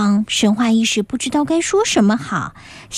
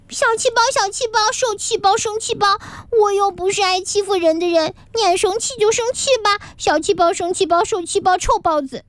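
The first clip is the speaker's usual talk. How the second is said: much higher chanted name-calling; aggrieved taunting; 7 semitones higher than usual. Acted. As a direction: yell teasing names after him, hurt. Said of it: "委屈地嚷起来". (女孩委屈地高声嚷着小气包)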